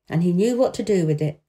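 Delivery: ordinary delivery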